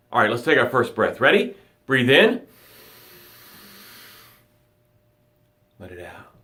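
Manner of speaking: calm them down, breath by breath; guided meditation. Acted. Leads a breathing gratitude meditation.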